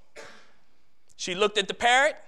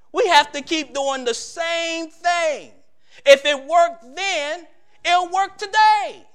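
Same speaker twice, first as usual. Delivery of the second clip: rising, louder preacher's call; preaching at full force; 5 semitones higher, 5 dB louder than usual. Acted. Preacher urging the church with a rising, fervent call. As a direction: drive the point home to the congregation.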